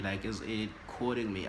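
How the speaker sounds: ordinary delivery, nothing marked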